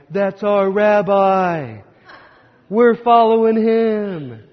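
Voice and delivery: slow, high, loud sing-song brag; proud boasting. Retold or imitated. Disciples boasting in the corner about their rabbi, slow sing-song brag. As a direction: crow about your rabbi like a proud fan.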